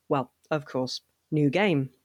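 ordinary talk, nothing marked

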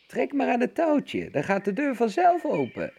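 veel hoger, piepend nagedaan omastemmetje; de wolf piept alsof hij oma is; piepende wolf. A narrator's reading. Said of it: "piepte de wolf". (wolf die zich als zieke oma voordoet, piepend hoog)

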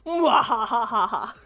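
slow, loud villain cackle; drawn-out mock-evil mwahahaha; evil laugh. Canadian joking about taking over, hammed-up evil laugh.

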